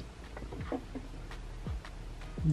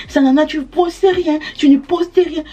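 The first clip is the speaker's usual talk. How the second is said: higher-pitched mock plea; mock imploring; 6 semitones higher than usual. Followers begging Sanana to post, mock imploring. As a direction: imitate someone begging for a post. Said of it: "partir là-bas l'implorer".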